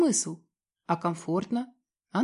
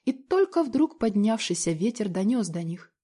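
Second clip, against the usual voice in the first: ordinary delivery, nothing marked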